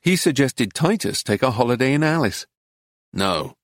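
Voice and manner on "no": low, soft, grim refusal. A narrator's reading. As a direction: refuse grimly in a low, quiet voice. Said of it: "said Titus grimly".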